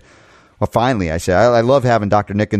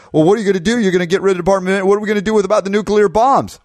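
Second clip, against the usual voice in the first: high, fast mocking whine; mock the complainers in a high squeaky voice; mocking complaint; 8 semitones higher, much faster than usual. Host mocking the complainers, high squeaky whine. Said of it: "When they complain that".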